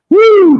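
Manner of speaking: high, loud "woo" of welcome; welcoming whoop. Real, not acted. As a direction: cheer the guest in with a whoop. Welcoming the guest on screen.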